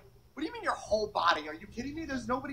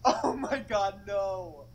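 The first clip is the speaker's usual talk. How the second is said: laughing; 4 dB louder, much slower than usual. Real, not acted. Laughing at her friends' dare, embarrassed giggle. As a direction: laugh through oh my god.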